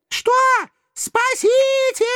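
very high, drawn-out shriek for help; shrieking damsel in danger; panicked nereid. A narrator's reading. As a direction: scream in a thin terrified voice. Nereid panics at being eaten.

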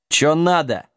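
ordinary delivery

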